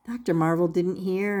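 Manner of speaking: ordinary delivery, nothing marked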